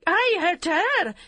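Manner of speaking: high, loud, slow outraged demand. A narrator's reading. Yum to her husband, outraged and loud. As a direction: throw the question back at him. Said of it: "Yum demanded".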